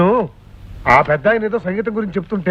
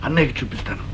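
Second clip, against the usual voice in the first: low, conspiratorial plotting; scheming; 7 semitones lower than usual. Acted. Schemer lays out plot. (కుట్ర పన్నుతున్న స్వరం)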